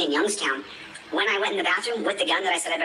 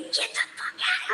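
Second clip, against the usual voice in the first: mock scream; slower than usual. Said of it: "I'm screaming".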